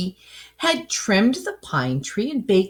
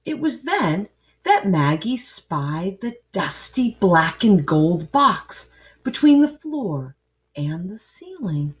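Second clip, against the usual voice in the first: plain reading voice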